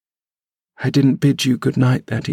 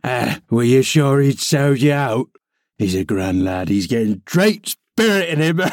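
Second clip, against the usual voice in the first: mocking Yorkshire old man; gloating old servant. A narrator's reading. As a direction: sneer it with malicious glee. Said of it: "malignant, crackly laugh".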